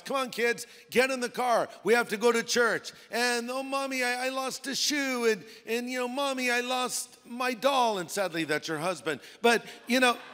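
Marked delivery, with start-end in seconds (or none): 0.0-2.9 put-on raised-pitch parent rounding up kids; hurrying parent. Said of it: "trying to get your kids out of the house". 3.5-5.2 put-on high little-kid whine; whining child. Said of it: "they're whining and complaining". 6.1-8.0 put-on high little-kid whine; whining child. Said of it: "they're whining and complaining".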